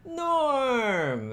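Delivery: long, high, drawn-out cheer of a name; mock group yell. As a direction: yell it like a bar greeting a regular. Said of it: "everybody would yell".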